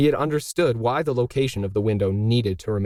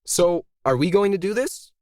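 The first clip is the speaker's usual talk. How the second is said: higher, clipped with impatience; impatient snap; 8 semitones higher than usual. A narrator's reading. Emily, losing patience with the two artists, snapping at them. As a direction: push them to get moving now. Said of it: "Emily snapped impatiently".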